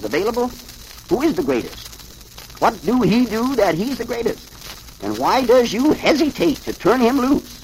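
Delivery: high-pitched hillbilly mother, insistent; Mammy Yoakum demanding answers. A narrator's reading. Mammy questions Available Jones, high and insistent. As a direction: press each question in a high voice.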